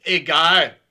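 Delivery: loud brush-off; dismissive. Acted. Kali brushes off Dominik's correction, loud dismissal. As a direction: blurt it out, waving the correction away.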